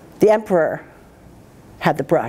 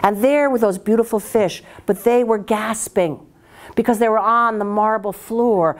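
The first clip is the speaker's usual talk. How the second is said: ordinary delivery, nothing marked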